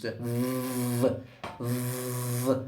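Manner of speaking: v buzzed softly with vibration; voiced V. Shown as a demonstration. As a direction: hum a voiced v. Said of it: "it is voiced".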